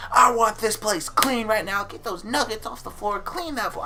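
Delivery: imitated screaming. Manager screaming at the crew to clean, imitated high yell. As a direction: bark cleaning orders at the whole crew. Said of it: "she starts screaming at the top of her lungs".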